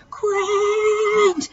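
very high, drawn-out call; boy calling his brother. A narrator's reading. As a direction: call him back across the yard. Calling brother across the yard.

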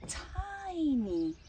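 ordinary delivery, nothing marked

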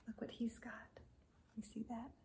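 hushed whisper. Acted. Reader whispering to children about the owl. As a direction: lean in and whisper.

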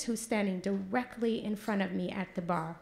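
ordinary delivery

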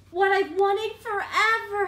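slow, loud, yearning pigeon; longing pigeon. Acted. Pigeon telling the reader his dream, slowly and loudly. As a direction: stretch out the longing. Pigeon's lines read aloud.

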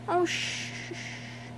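soft shushing to calm a frightened pup; hushing a dog. Real, not acted. Owner hushing her whining foster dog, gentle shushing. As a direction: shh it gently, like settling a scared animal.

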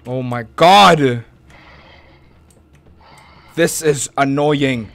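usual speaking voice

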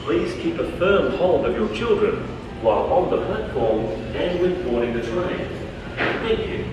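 train station announcement. Real, not acted. Platform PA safety announcement.

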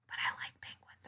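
soft confiding whisper; hushed fond whisper. Acted. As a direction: share it like a secret. Confiding to children.

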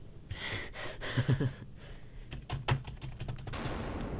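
quiet airy laughter; soft wheezing giggle; breathy laugh. Real, not acted. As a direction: laugh under the breath at yourself. Laughs at own failure.

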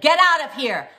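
high, very loud yell; yelled really loud. Retold or imitated. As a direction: scream her out of the store. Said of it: "I yelled really loud".

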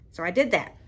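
normal talking voice

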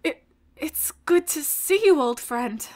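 barely getting words out. Acted. Character greeting an old friend, choked up. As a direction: choke on the opening, then let it sink. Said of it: "can barely get the words out".

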